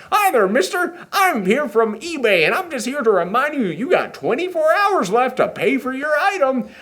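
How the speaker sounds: put-on very high, chirpy little boy voice; tiny delivery boy. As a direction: squeak it like a tiny courier boy. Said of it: "this little tiny blue boy".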